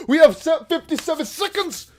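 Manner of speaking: high, loud hype shout; excited. Real, not acted. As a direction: announce it pumped up. Excited countdown call.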